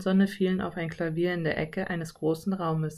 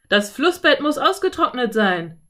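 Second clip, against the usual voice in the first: loud cowboy shout, pitched up; bellowed; 5 semitones higher, 6 dB louder than usual. A narrator's reading. Cowboy to his gang at the river, bellowing. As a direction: holler it across to the other riders. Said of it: "brüllte einer der Cowboys".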